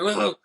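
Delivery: imitated wobbling yelp; shaken mock "whoa". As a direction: exclaim as if being rattled around. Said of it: "like, shaking, he's like".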